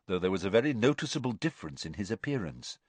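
ordinary delivery

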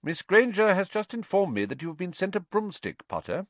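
stern professor. A narrator's reading. Teacher questioning a pupil about a broomstick, sternly.

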